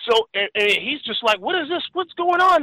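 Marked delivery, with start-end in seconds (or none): 1.4-2.6 higher, bewildered questions; mock confusion, pitched up; bewildered friend. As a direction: sound totally lost.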